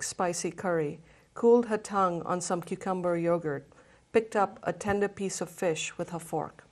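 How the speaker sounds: reads the feast scene aloud to the group; story narration. Acted. Book club reader sharing a feast scene, story narration. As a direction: let the scene unfold, just reading.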